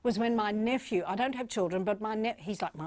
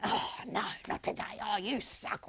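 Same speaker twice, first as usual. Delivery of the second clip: judgemental disdain; 6 dB quieter than usual. Acted. Mimicking people judging those they look at, muttered disdain. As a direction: grumble it quietly, as if judging someone. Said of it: "and judging people".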